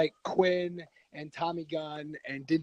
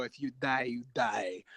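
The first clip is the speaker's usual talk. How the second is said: low-pitched, quieter deadpan impression; hint of a stoic boxer's threat, pitched down; Ivan Drago; 5 semitones lower, 5 dB quieter, slower than usual. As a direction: lightly do a grim movie-villain line, dropped low. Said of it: "I'm pulling Ivan Drago".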